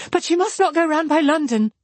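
high-pitched, agitated plea; hurried feverish cry. A narrator's reading. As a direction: protest urgently, as if delirious with fever. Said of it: "cried Marianne in the same hurried manner".

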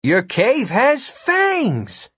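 proud, drawn-out and high. Acted. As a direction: announce it with pride. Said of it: "in a proud voice".

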